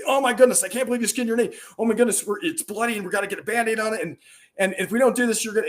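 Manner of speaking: high, loud panicked dad; freaking out. Acted. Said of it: "I freak out and say".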